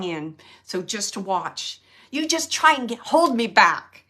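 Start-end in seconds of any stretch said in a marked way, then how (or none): 2.1-4.0 higher, louder dare, the last words slowed; loud playful challenge. Retold or imitated. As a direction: toss out a cheeky dare on the father's behalf. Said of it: "He's saying it".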